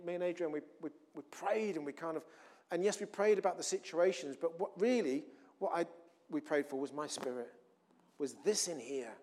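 usual speaking voice

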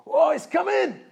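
high excited squeal; excited heaven. Retold or imitated. Preacher imitating heaven's excitement at the coming birth. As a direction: squeal it out with joy. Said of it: "can you imagine ... the Trinity there in heaven".

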